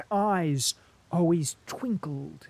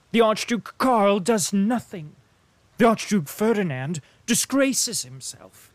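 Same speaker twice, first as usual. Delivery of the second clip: higher, louder ironic lecture; a lightly voiced worldly diplomat, teasing; sardonic diplomat Bilbin; 5 semitones higher, 6 dB louder than usual. A narrator's reading. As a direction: needle the soldier with mock grandeur. Bilbin explaining the Austrian view.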